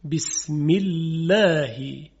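بسم الله slow, with a light, thin lam in الله. Shown as a demonstration. Teacher demonstrating the thin lam after kasra.

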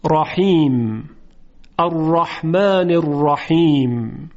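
رحیم slow with a thick ra, Quranic style; heavy ra recitation. Shown as a demonstration. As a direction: recite الرحمن الرحیم slowly, ra heavy. (الرحمن الرحیم با رای درشت و تجویدی)

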